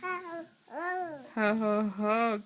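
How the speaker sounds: Santa laugh. Pretend. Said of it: "what does Santa say?".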